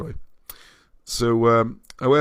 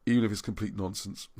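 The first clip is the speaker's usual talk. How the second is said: ordinary talk, nothing marked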